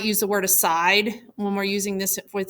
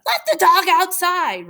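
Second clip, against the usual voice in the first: higher and louder, a lightly acted rude shout; mock rude yell; 7 semitones higher, 4 dB louder than usual. Teacher voicing Joseph rudely yelling to Gianna, mock yell. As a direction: toss it off like a rude brother calling across the house. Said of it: "rudely, Joseph yells to Gianna".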